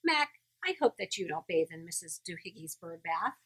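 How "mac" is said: usual speaking voice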